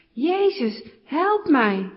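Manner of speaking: high, loud cry for help; calling even harder. A narrator's reading. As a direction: call out desperately to be heard. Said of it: "Nog harder roept hij".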